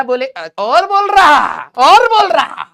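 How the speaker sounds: loud, high yell, last word stretched; mock scolding shout. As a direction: yell at a cheeky student in play. Mock scolding of a talkative student. (बनावटी डाँट, ऊँची आवाज़ में चिल्लाकर)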